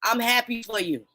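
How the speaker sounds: ordinary delivery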